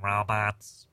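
low pinched-nose monotone; monotone robot. Shown as a demonstration. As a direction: drone it like a robot. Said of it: "speak in a monotone like this".

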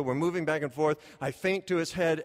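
ordinary talk

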